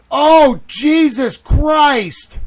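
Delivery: very loud, high, slow outcry; drawn-out, high-pitched horror; horrified shout. Real, not acted. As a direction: cry out in shock at the sight. Streamer sees horrifying footage.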